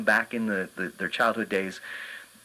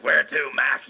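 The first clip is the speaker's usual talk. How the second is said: Oscar the Grouch; 3 semitones higher, 4 dB louder, slower than usual. Retold or imitated. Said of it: "he started talking Oscar the Grouch".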